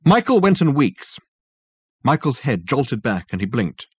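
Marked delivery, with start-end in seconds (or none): 0.0-1.2 loud, high bark of a name. A narrator's reading. Dirk to the figure on the stool, barked. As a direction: bark it out to rouse him. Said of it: "barked at the figure".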